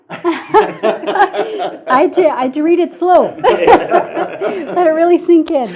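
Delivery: loud, high words bursting through real laughter; helpless laughter breaking up the sentence; laughing hard. Real, not acted. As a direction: crack up while explaining yourself. Cracking up over own slow reading.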